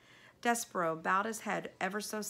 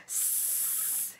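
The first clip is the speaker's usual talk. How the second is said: soft unvoiced hiss of rats; 6 dB quieter than usual. A narrator's reading. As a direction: hiss through your teeth. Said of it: "hissed the other rats".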